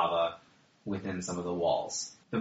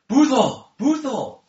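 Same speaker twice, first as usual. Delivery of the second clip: shouted high and loud; calling out; 10 dB louder than usual. Acted. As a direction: yell the name across the hall. Dwarf calling out for Boothel.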